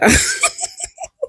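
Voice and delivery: high, squeaky giggle; laugh. Real, not acted. Confessing she turns everything to anger, amused laugh.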